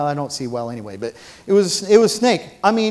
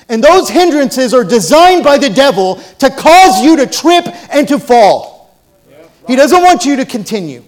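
higher, louder preacher's build; full-force preaching; 5 semitones higher, 9 dB louder than usual. Acted. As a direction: preach it hard to the congregation.

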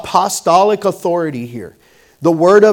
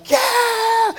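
much higher joyful yell after a goal; excited cheer. Retold or imitated. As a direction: whoop like you just scored. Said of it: "I'm so excited".

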